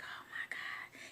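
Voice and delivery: breathless whispered oh my God; awed whisper. Retold or imitated. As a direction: hush it out, stunned at being called. Overwhelmed, called up front.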